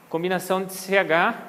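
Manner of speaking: ordinary talk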